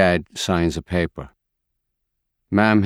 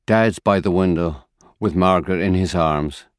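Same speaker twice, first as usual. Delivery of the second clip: ordinary delivery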